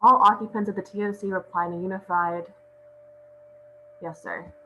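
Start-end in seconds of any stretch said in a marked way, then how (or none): none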